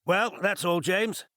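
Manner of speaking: cheerful. Acted. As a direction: brightly wave him off, voice lifted high. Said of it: "He said cheerfully".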